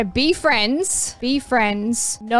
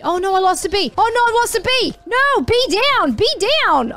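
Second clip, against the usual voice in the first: alarmed wail; 9 semitones higher than usual. Real, not acted. Losing bees in game.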